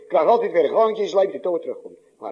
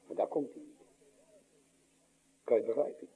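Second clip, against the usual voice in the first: whispered; 4 semitones lower, 14 dB quieter, faster than usual. Acted. Sudden drop after the climax.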